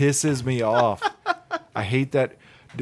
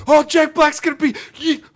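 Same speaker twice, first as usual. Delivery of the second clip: very high, loud reenacted excitement; mock excited squeal; 6 dB louder than usual. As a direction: act out a thrilled squeal that gets cut off. Acting out own excited reaction, cut short.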